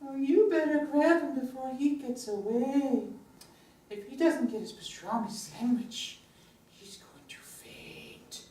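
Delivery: high little creature chatter; small, high-pitched puppet voice; tiny unicorn voice. Acted. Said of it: "speaks in a tiny, tiny voice".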